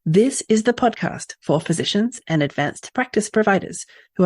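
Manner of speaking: loud, emphatic host read with measured phrasing; polished podcast opener, projected and deliberate; scripted podcast intro. Acted. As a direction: announce the show, punching the key words.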